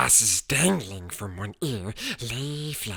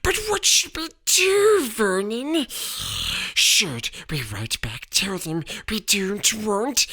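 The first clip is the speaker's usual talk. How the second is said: anxious wife; 10 semitones higher than usual. A narrator's reading. Petunia anxious, asking Vernon.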